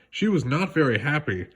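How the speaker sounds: usual speaking voice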